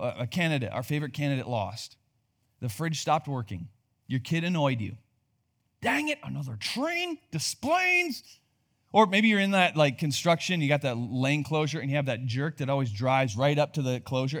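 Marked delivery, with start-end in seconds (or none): 5.8-8.2 high-pitched mock frustration; mock exasperation. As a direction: whine it in a much higher voice. Acted driver annoyed by delay.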